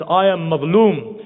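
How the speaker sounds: crying out. Acted. Starving stranger entering Medina, crying out slowly. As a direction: call it out at the top of your voice. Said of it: "he says on the top of his voice".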